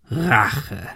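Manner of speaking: villain's drawn-out single word; scornful villain. A narrator's reading. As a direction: echo the word with contempt. Gunman echoes the word mockingly.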